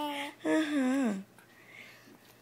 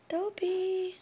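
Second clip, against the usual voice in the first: usual speaking voice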